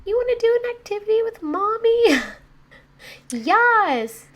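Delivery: very high, loud cooing squeal; melting mom. Retold or imitated. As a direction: gush like a mother whose heartstrings got pulled. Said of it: "He pulled on those heartstrings so hard".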